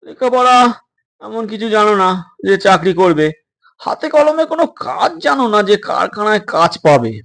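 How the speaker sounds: stern doctor. Acted. Doctor scolds runaway boys.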